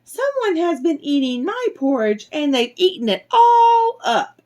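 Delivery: high, tiny little bear voice; high-pitched baby bear crying out. A narrator's reading. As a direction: wail it in a high little-cub voice. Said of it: "cried out in his little tiny voice".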